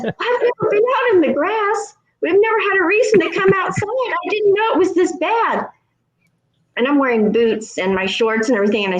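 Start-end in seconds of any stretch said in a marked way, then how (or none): 0.0-5.7 high, naive mock voice; imitating the neighbor. Neighbor explaining to narrator, high naive voice. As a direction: play her clueless and earnest. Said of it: "she says".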